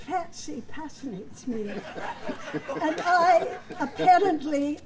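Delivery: slows and breaks into laughter; flubbed line. Retold or imitated. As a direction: stall mid-line, laughing. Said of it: "But instead he said".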